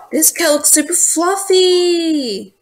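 high, slow, drawn-out gushing. Real, not acted. Player admiring a fluffy cat, gushing. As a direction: stretch it out in high-pitched delight.

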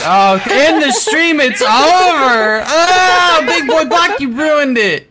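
loud, high, drawn-out mock wail; hammed-up despair. Friend declares the stream over, hammed-up despairing wail. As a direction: wail it as if all is lost.

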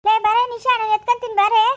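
put-on aunt calling the crying girl over loudly. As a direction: call the girl over, loud and impatient.

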